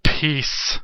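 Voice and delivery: mock a cocky gamer, then sign off; mocking Freak. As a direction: sneer it like a cocky player. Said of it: "he's like".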